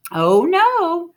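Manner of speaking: startled teacher's outcry; dismayed exclamation. Acted. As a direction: catch the temper coming and exclaim. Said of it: "Annie exclaimed".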